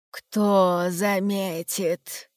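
very slow, low menacing voice; sinister voice. Acted. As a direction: loom behind them, slow and ominous. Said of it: "раздался зловещий голос за спиной".

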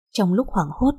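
plain reading voice

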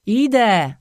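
loud, high answering shout; distant shout. Acted. The lost boy answers from the forest, a loud high shout. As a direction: shout back from far off in the woods.

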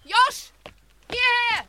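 high, loud call across a distance. Acted. Linda calling Josh to come to her, loud and high. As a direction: shout to get him over here.

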